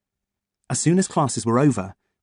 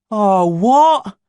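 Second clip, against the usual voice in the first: high, loud disappointed moan; 10 semitones higher, 8 dB louder than usual.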